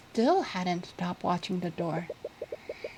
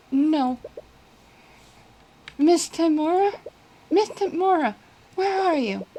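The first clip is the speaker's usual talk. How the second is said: panicked calling; 7 semitones higher, 3 dB louder than usual. Acted. Searching frantically for missing teacher.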